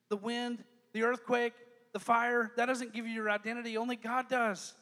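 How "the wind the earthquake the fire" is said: usual speaking voice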